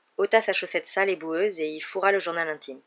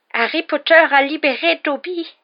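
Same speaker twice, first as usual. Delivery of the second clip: very high, loud, slow elf cry; squeaky creature, loudly drawing out each word; shrill elf cry; 10 semitones higher, 8 dB louder than usual. A narrator's reading. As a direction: proclaim your freedom in a tiny high voice. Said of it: "répéta-t-il".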